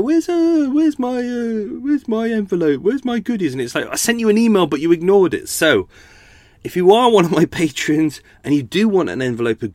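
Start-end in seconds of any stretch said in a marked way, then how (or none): 0.0-3.5 much higher, whining where's my envelope; mock whiny patron. Patrons nagging about missing envelopes. 3.9-5.5 exasperated retort. Retold or imitated. Exasperated reply to patrons.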